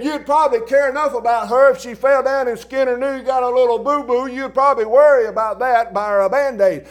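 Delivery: high, loud scolding of a man; loud rebuke. Retold or imitated. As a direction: preach hard at the uncaring husband. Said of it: "I said".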